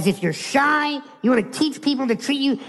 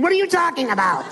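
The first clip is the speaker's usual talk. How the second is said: higher, quick incredulous outburst; comically exasperated question; mock incredulity; 6 semitones higher, faster than usual. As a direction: throw it out as an absurd punchline. Incredulous punchline to friend.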